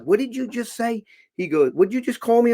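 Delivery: high-pitched, incredulous retort; indignant challenge. Retold or imitated. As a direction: challenge him, pitch jumping up. Retells his challenge in quarrel.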